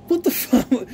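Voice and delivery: half-laughing what the fuck; disbelieving outburst. Retold or imitated. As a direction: blurt it out, stunned. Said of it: "so I'm like".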